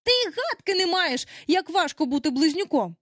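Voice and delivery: put-on high, emphatic childish protest; high-pitched outburst; exclaimed. A narrator's reading. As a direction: insist indignantly as one of the twins. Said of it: "вигукнули близнюки".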